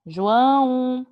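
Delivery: loud, drawn-out name call; stretched call across the class. Real, not acted. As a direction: call out for a pupil who hasn't answered. Calling on an absent pupil.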